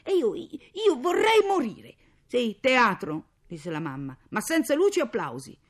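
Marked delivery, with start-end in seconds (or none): 0.0-2.0 high, loud, drawn-out wail; melodramatic shout. A narrator's reading. 2.3-3.3 loud, slow sarcastic jeer; mocking. Mother mocks the girl's dramatics. 4.3-5.6 loud, high sarcastic retort; mocking. Mother mocks the girl's dramatics.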